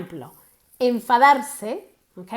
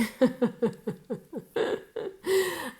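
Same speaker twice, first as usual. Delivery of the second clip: krótki zawstydzony śmiech; soft embarrassed laugh at herself; sheepish laugh; 3 semitones higher, 7 dB quieter than usual. Real, not acted. Laughs at own admission.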